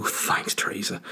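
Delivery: soft, whispered thanks; hushed mock gratitude. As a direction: drop to a meek little whisper. Replying gratefully to imagined Theresa.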